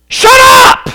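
high, loud shout of shut up; shouted command. Shown as a demonstration. Jesus ordering the sea to be silent, a loud shout. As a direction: bark it at the storm. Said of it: "Or you could translate it".

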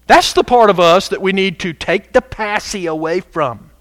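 ordinary talk, nothing marked

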